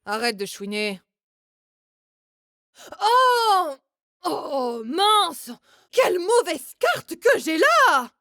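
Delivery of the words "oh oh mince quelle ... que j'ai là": fake dismay. Fakes a bad hand at poker.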